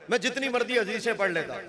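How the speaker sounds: ordinary talk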